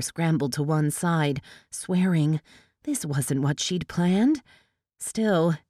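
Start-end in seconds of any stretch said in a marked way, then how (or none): none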